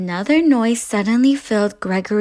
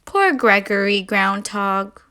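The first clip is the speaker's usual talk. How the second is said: ordinary delivery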